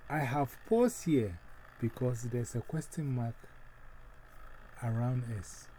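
ordinary talk

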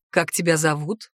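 low male-character question; lightly voiced man. A narrator's reading. Centaur asking her name, in a lightly voiced low register. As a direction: drop the pitch for the man's question.

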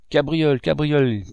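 loud calling out; shouting. A narrator's reading. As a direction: call out his name loudly. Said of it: "crièrent les deux enfants".

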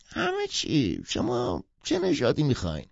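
high-pitched put-on old man's voice; old dog seller, obliging. Old puppy seller answering a customer, obliging.

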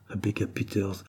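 ordinary delivery, nothing marked

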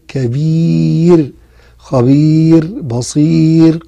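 كبير drawn out very slowly with a thin R at the pause; slow tajweed list of words; light R demo. Shown as a demonstration. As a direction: stretch each word, light R. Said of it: "إذا وقفت على كلمة كبير".

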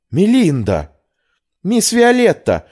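high-pitched cries of surprised recognition; astonished exclamations. Acted. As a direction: call out the name in amazement. Unexpected reunion of maid and mistress.

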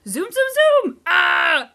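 high shouted zoom zoom and a squeal; zooming little dragon. Retold or imitated. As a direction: zip around shrieking like a tiny dragon. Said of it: "He's just like".

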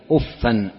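doubled f, then "-an" on أفا; variant with tanween. Shown as a demonstration. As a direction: say أفا as "uffan", ending in an n. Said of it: "بتنوين وبغير تنوين".

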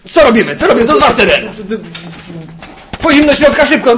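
high, loud rushed orders; urgent shouting. Real, not acted. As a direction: shout the plan under fire. Under attack, urgent.

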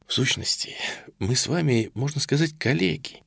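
soft, ingratiating confidence; softened, familiar tone. A narrator's reading. Colonel courting the captive major as a colleague, softly ingratiating. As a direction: drop the volume and cosy up to the listener. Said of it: "умело смягчив тон, внеся в него даже некоторую фамильярность".

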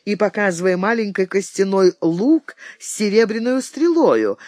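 ordinary delivery

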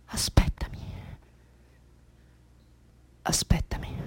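soft, low, breathed whisper; whispered plea. Acted. Faint plea after the cry. (supplica sussurrata)

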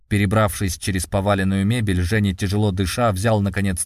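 usual speaking voice

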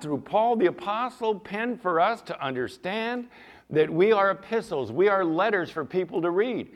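loud, high preaching push; fiery preaching. Acted. Preacher driving the point home to the congregation, loud and high.

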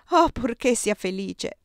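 usual speaking voice

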